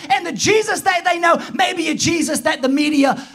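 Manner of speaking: high, urgent preacher's voice; impassioned preaching. Real, not acted. Preacher explaining unbelief to older members, impassioned preaching. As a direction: drive the point home fervently from the pulpit.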